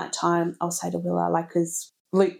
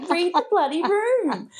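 higher, slow, exaggerated annoyance; mock exasperation; 11 semitones higher, slower than usual. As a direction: roll your eyes at a tactless husband. Exasperated joke about husband.

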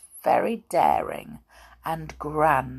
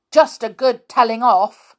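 fierce; 6 semitones higher, 9 dB louder than usual. Acted. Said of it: "said the teddy rather fiercely".

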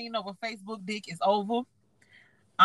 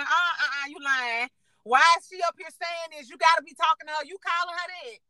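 higher-pitched retold accusation; cussing him out; 6 semitones higher than usual. Woman confronting a man about another girl, accusing. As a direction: press him, as if catching a liar. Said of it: "I cussed him the fuck out".

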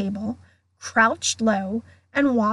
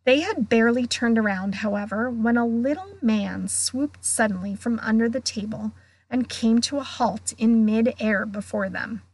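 usual speaking voice

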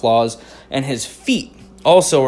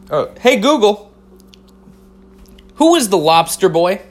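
higher, louder call to the phone; calling the assistant; 7 semitones higher, 5 dB louder than usual. Real, not acted. Host asking his phone about Lobster Boy, raised call.

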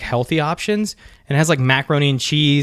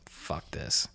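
muttered under breath; 13 semitones lower, 18 dB quieter than usual. Real, not acted. Defeated mutter.

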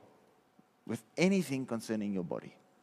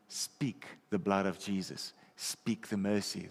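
ordinary talk

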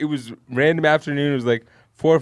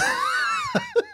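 high shrieking laugh; 5 dB quieter than usual. Real, not acted. Cracking up at the end.

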